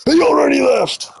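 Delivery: high, mock yelling chatter. Streamer imitating a chatter yelling at him, high mock yell. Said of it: "You don't have to yell at me".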